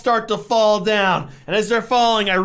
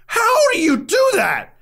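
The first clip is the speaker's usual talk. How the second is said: much higher, slower, drawn-out question; stunned disbelief; 11 semitones higher, slower than usual. Real, not acted. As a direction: plead it in utter disbelief.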